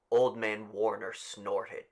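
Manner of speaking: plain reading voice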